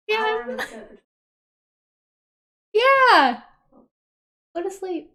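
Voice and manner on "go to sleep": normal talking voice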